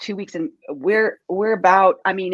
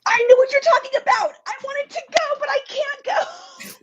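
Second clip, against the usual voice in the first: excited; 3 dB louder than usual. Real, not acted. Excited then disappointed friend.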